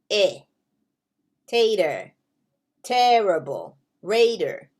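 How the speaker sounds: "eh", "tater", "terrible", "raider" duidelijk en dalend uitgesproken; short E words. Shown as a demonstration. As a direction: lees de rij langzaam voor. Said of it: "So that's the short E".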